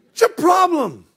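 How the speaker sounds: high, loud outburst; acted yelling. Frightened disciple yelling at Jesus in the storm, loud shout. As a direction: shout it like an exasperated shipmate. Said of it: "somebody's yelling that".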